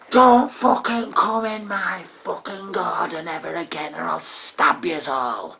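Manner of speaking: high, loud threatening snarl; menacing neighbour. Acted. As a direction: threaten them, slowing at the end. Neighbour threatens kids with knife.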